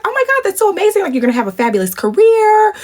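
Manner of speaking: high, delighted hype; singsong, glowing praise; gushing encouragement. Retold or imitated. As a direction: hype them up in an imitated high voice. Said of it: "really speaking, like, life into me".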